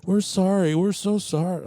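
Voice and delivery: high, hammed-up sorry; mock sheepish apology. Camper lady apologizing after being proven wrong, mock sheepish. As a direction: grovel with exaggerated embarrassment. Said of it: "Oh, oh".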